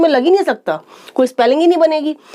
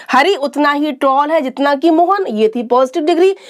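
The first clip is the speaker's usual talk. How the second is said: ordinary talk, nothing marked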